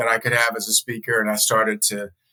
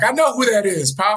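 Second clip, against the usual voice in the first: high, playful retort; mock indignant; 9 semitones higher than usual. Telling Pop he knows Oprah, high mock indignation. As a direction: throw it back at him, half laughing.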